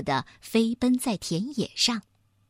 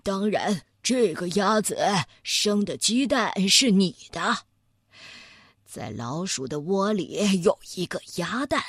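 low-pitched scholarly bear, measured explanation; deliberate bear detective; 5 semitones lower than usual. Acted. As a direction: lay out the solution unhurriedly.

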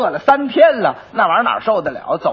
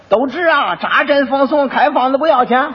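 higher street-seller's cry; hawker's call; 6 semitones higher than usual. Acted. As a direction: shout your wares to passers-by. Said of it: "又嚷嚷上了".

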